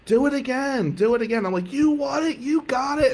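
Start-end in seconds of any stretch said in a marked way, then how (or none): 0.1-1.4 high-pitched mock crowd chant; excited retold shouting, pitched way up; screaming crowd. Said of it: "the crowd starts screaming". 1.7-3.1 hyped-up showman. Retold or imitated. Hyped-up reply to cheering crowd.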